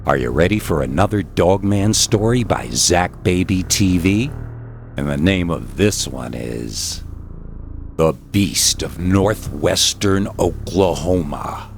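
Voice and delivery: plain reading voice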